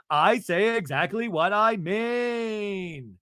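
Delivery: retold outburst, high and drawn out; exploding. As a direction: shout it slowly, stretching the last word. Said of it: "just explodes".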